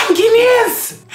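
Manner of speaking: gasp, then high loud call-out; sudden high-pitched outburst; mock confession shout. Confession, then calling out own people.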